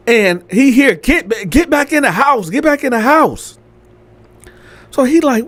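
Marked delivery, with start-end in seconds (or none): 1.0-3.6 high-pitched mock ordering; arguing girl's voice. Girl ordering someone back inside, insistent. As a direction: act out a woman insisting, pitched up. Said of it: "that was that girl voice".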